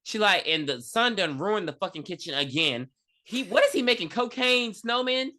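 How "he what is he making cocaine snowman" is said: retold outrage. Mom's outrage at ruined kitchen, retold.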